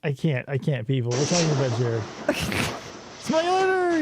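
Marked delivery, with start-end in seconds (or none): 2.3-4.0 slow, higher cartoonish voice; drawn out, much higher character voice; imitating a character. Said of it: "he says".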